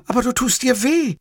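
very high woman's voice; protesting Livia. Acted. Livia objects during the embrace, high-voiced protest.

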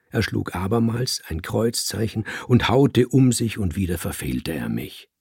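ordinary delivery, nothing marked

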